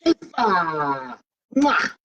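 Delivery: excited greeting. Real, not acted. Greeting his cousin, blowing kiss.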